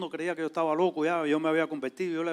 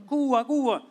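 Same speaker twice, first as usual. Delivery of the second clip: higher, louder cheerful call; retold shouting; 8 semitones higher, 5 dB louder, slower than usual. Men in the neighbourhood calling to the Cuban visitor, high loud call. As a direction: hail him by his country's name. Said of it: "todos los morenos ahí me gritaban".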